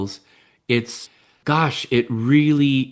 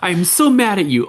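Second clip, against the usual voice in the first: higher-pitched acted annoyance; mock mad partner; 8 semitones higher, 4 dB louder than usual. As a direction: complain at a partner, playfully overdone. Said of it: "would be to your partner".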